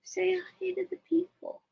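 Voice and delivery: high whispered coax; whisper to bird. Real, not acted. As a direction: whisper the request to the parrot.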